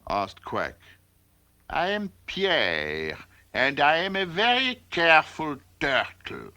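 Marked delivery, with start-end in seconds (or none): 1.7-6.5 lightly voiced turtle with a French accent. A narrator's reading. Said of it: "Pierre answered with his French accent".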